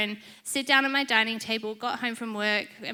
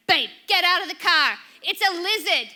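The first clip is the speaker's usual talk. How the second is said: loud, raised husband's order; urgent warning; 6 semitones higher, 5 dB louder than usual. Retold or imitated. Husband orders wife out over a lizard, urgent loud warning. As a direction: imitate an alarmed husband calling out.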